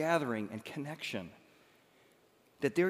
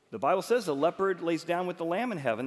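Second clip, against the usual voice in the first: ordinary talk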